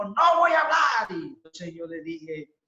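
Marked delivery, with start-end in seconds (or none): 0.0-1.1 mock wife shouting, higher and louder. Upset wife refusing to talk, imitated shout. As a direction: yell the refusal in an imitated fury. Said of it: "empezó a gritar y dijo".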